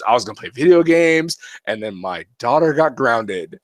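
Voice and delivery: ordinary talk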